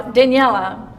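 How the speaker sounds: ordinary talk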